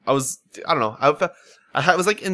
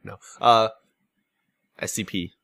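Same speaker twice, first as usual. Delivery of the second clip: ordinary talk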